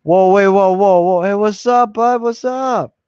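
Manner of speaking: high, loud, eager hello to a friend; excited greeting. Real, not acted. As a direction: call out the greeting with real delight. Greeting a viewer joining live.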